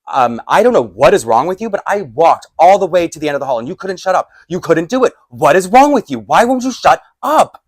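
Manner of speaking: loud, high, mounting outburst; snapped, furious. Retold or imitated. Snapping at the man who wouldn't shut up, furious. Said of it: "I just snapped".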